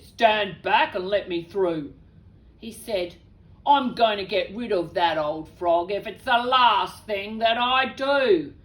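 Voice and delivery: a dad charging in to fix it; gruff dad. Acted. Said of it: "Dad pulled up ... he said".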